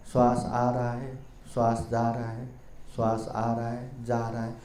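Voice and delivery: hushed meditation guidance; guided meditation. Acted. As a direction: lull the listener into steady breathing. Narrator leads the breathing meditation.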